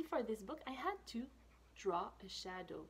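usual speaking voice

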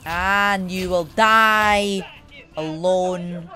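slow, drawn-out put-on voice; playful doom-laden threat, stretched out. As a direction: proclaim it slowly in a silly voice. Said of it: "Why am I using this voice?".

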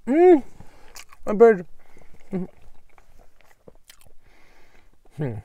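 mumbled through food; talking while chewing. Real, not acted. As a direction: mouth full of sandwich. Seen 0: Bites sandwich, covers mouth while chewing, talks between bites.

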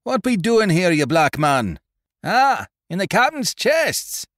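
high, loud, furious challenge; angry accusing sailor. A narrator's reading. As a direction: catch the thief red-handed. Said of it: "the voice of David Spry".

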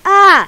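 a sudden loud pained "Ah!" up high; startled yell. Real, not acted. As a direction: cry out as you get squashed. Dies in game, cries out.

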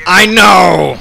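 high, loud, drawn-out holler; exasperated shout. Real, not acted. Player answering the game's announcer, exasperated shout. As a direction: shout back, fed up. Snaps back at game voice.

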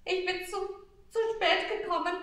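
put-on high, loud, hurried child's sob-talk; a little shepherd boy choking out his lateness; sobbing. Shepherd boy to the star, sobbing. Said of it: "schluchzte der kleine Hirt".

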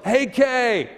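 loud, high holler to get attention; shouted out to a co-worker; yelled call. Retold or imitated. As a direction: yell it across a crowded booth. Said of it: "he yells".

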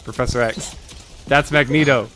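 normal talking voice

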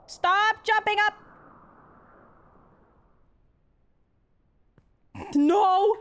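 high, loud, slow yelling; shrieking no at the screen; frustrated scream. Real, not acted. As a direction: yell at the character to stop. Screaming at the game character.

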